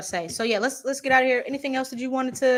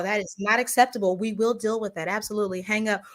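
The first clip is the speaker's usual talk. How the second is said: ordinary delivery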